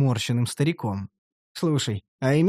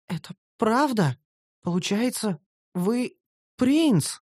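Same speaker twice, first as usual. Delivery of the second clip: higher, slow wondering elf; slow elf girl; 6 semitones higher, slower than usual. Acted. Elf girl slowly asks if her master is a prince, higher voice. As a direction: ask slowly in awe. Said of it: "медленно произнесла Карамелька".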